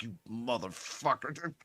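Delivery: low, very quiet, drawn-out seething; mock seething. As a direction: imitate silent fury, slowly under the breath. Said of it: "you know how irate that made".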